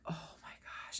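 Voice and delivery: whisper. Retold or imitated. Friend shocked by bad news.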